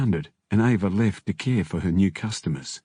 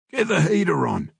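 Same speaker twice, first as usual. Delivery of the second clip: higher-pitched call without much volume; mild yell; 9 semitones higher than usual. A narrator's reading. Big Kev demanding heat in the car, mild yell. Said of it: "Big Kev yelled".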